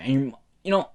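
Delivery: ordinary delivery, nothing marked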